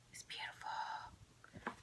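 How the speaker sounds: soft unvoiced whisper. Real, not acted. Vlogger whispering a compliment to a fellow stitcher. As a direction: breathe it like a secret.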